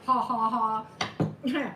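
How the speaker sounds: slow, deliberate fake ha ha ha; spelled-out cheesy laugh; mock laugh. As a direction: laugh at her own pun on purpose. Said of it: "really cheesy humor there".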